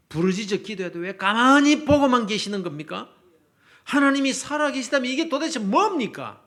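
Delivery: 크게 부르짖는 예언자의 항변; 목소리를 높여 하나님께 따져 묻기; 당돌한 항의. Acted. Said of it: "이러면서 당돌하게 나옵니다". (당돌하게 따지는 큰 목소리)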